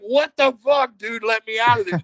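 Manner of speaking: high, loud outraged protest; comic desperate yelling; retold exasperated plea. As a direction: act out demanding to be released from a hold.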